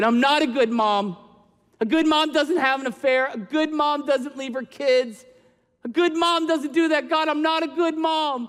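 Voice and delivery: high, loud, raw retold cry; yelling at God. Mom yelling at God that she's not a good mom, raw and loud. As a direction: cry out at God as if he's wrong. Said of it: "I yelled at God".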